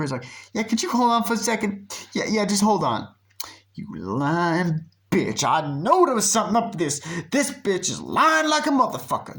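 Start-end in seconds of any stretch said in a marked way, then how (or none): 0.5-3.1 mock stalling. Friend stalling on the phone. 3.7-9.4 mock outrage. Friend ranting about liar.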